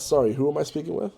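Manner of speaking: usual speaking voice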